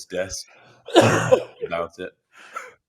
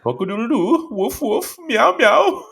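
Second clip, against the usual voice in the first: much higher, louder mock animal noises; Semi's alter ego; 7 dB louder than usual. Describing Semi going off on one, hammed-up animal noises. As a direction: ham up a teammate's silly animal calls. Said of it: "he turns into this alter ego".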